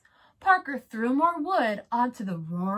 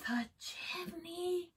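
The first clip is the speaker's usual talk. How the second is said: soft breathy whisper, slowly; 7 semitones higher, 11 dB quieter, slower than usual. Acted. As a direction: hush it like a sly idea. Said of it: "whispered the wolf".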